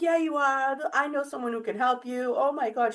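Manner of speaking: high, eager, insistent chatter; sister-in-law insisting on the phone, high and eager; excited friend. Retold or imitated. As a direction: mimic her gushing certainty. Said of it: "She's all excited".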